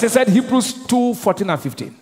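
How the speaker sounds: ordinary delivery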